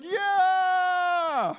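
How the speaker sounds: loud, high, long-held cheer; celebratory yell. Shown as a demonstration. Preacher showing how fans cheer at a game, drawn-out yell. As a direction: let out a big stadium yell. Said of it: "I'm yelling".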